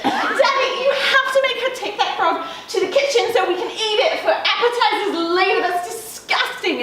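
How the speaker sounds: high posh English whining; fake English accent. A narrator's reading. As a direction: complain to Daddy in put-on English accent. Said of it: "speaking in a fake English accent".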